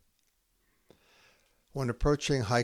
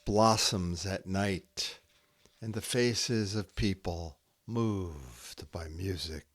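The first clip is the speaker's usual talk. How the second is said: slow haiku reading with long pauses; poem reading; 7 semitones higher, slower than usual. Acted. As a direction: let each image hang in the silence.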